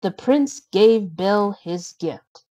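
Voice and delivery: ordinary delivery, nothing marked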